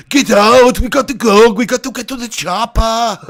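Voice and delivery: loud, high, badly faked Austrian accent; Arnold. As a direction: bellow a terrible action-hero impression. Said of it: "That was the worst Arnold impression ever".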